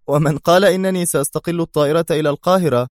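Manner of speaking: sly mocking retort. A narrator's reading. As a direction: toss it off with a smirk. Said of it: "ضحكة ساخرة أخرى قبل أن يقول".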